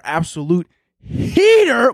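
high, drawn-out hype shout; hyped exclamation. Real, not acted. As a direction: stretch it out high with excitement. Hyping a new song.

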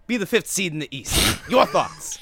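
loud debate-host handoff; Stephen A impression. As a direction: toss it over with a challenge. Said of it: "I was gonna go Stephen A on this".

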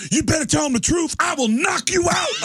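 hammed-up angry boyfriend warning; mock threat. Man threatens woman in front of cop, mock shouted. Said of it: "on camera goes".

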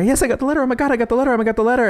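high, breathless mock panic; panicked friends. Friends getting the letter, high mock panic. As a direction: squeal it like a panicking schoolgirl.

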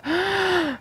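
sharp fake gasp; mock gasp. Mock shock at free speech.

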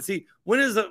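normal talking voice

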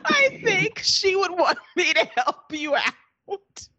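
high voice cracking with laughter; quoting Booker through helpless laughter; laughing through a quote. Retold or imitated. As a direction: try to quote Booker while losing it laughing. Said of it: "direct quote from Booker".

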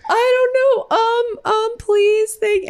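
panicking at the counter, voice jumping way up; flustered and timid. Retold or imitated. Nervous tourist rushed at a bagel counter, high flustered squeak. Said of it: "And I'm like".

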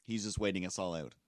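ordinary talk, nothing marked

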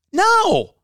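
high-pitched mock denial; a quick squeak of a no; squeaky no.

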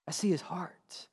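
breathy whispered echo. Retold or imitated. Child about dad's prayer, softly whispered. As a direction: let it drop to a hushed whisper.

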